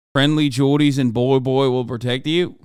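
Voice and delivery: ordinary talk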